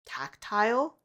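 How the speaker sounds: "tactile" as TAK-tile, rising like a question; questioning TACT-ILE. Shown as a demonstration. Vlogger tries out the word, "tactile" as questioning TAK-tile. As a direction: say "tactile" with a long "eye" in the second syllable, unsure. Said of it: "I think the word is".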